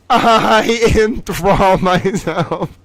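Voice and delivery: slow, laughing through the words. Real, not acted. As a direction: giggle it out slowly. Repeats claim while laughing.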